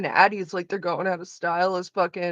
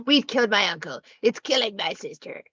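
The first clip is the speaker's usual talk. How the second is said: Valley girl impression. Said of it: "the classic Valley girl".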